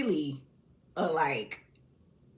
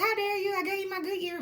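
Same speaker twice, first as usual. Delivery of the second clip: high, indignant cry of a wronged wife; mock outrage; 13 semitones higher than usual. Wife confronting her cheating husband, hammed-up high outrage. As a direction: squeal it like a betrayed wife, hammed-up. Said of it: "you're mad".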